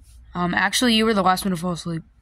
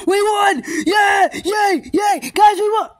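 excited cheering; 9 semitones higher, much slower than usual. Acted. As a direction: celebrate the win. Victory cheering.

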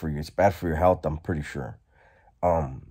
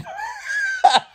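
a howl of laughter way up high; shrieking laugh; 4 dB louder than usual. Real, not acted. After teasing the cellmate, a high shrieking laugh. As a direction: laugh out loud, high and shouted.

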